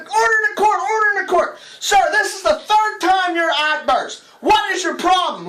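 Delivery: put-on high, loud judge shouting at the old man; fed up, yell at the repeat offender; exasperated judge.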